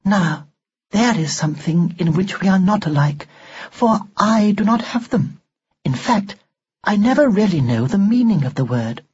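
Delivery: high, prim and emotionless; an old-fashioned doll speaking without feeling; expressionless doll voice. A narrator's reading. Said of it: "Expressionless, as always".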